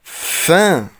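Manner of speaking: فان said as nasal 'fã', no final n; فان with the n dropped into the nose; nasal French vowel. Shown as a demonstration. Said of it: "خدت بالي من النطق؟".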